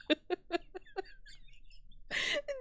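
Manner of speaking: breathless high laughter; long high wheeze laugh. Real, not acted.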